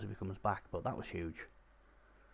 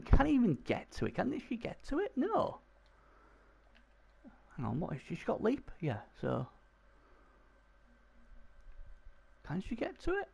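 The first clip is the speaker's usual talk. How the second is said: rising high with excitement, genuinely asking; excited high disbelief; 13 semitones higher than usual. Real, not acted. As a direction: voice jumping way higher, excited. Excited commentary on a play.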